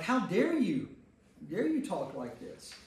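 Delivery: ordinary delivery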